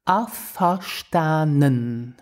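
"Afverstanden." stretched slowly, every syllable clear; slow practice. Shown as a demonstration. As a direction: drag out "Afverstanden." for learners. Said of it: "Let's repeat to practice the pronunciation".

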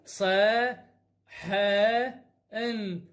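ص، ح، ان as three separate sounds, "saa, haa, enn"; slow phonics demonstration of ص، ح، ان; sounding out letters. Shown as a demonstration. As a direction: spell out ص، ح، ان with long pauses between. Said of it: "صارت".

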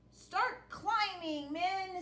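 captain's order. Acted.

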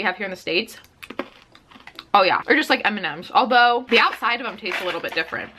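talking with candy in mouth; slightly muffled chewing speech. Real, not acted. Seen 1: Candy put in mouth at -2; lips work while she talks.

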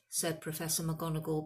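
plain reading voice

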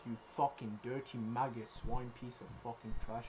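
low, soft contemptuous taunt; quiet menace. Real, not acted. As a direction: hiss insults quietly.

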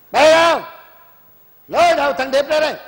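loud, high angry command; furious shout. Acted. Old man orders the disgraced boy dragged out, furious loud shout. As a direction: bark the order in rage.

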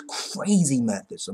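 usual speaking voice

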